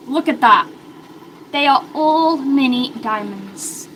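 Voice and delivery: normal talking voice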